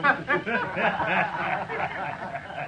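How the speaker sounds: a scornful, drawn-out laugh at the marshal; mocking laugh. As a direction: laugh him off as a joke. Trail hand mocks the marshal.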